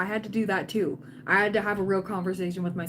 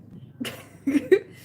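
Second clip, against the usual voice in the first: short laugh; 6 semitones higher, 4 dB louder than usual. Real, not acted. Laughing after teasing line.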